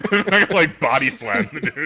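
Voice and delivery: high, loud words through real laughter; laughing protest. Real, not acted. Player cracking up at the body-slam idea, laughing protest. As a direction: crack up while insisting.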